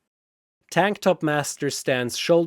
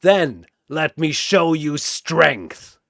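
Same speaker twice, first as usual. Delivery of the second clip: slow, louder, grim threat; defiant challenge; 2 semitones higher, 5 dB louder, slower than usual. Acted. Tank Top Master squaring up to Sephiroth, slow and defiant. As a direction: spell out each word as a vow.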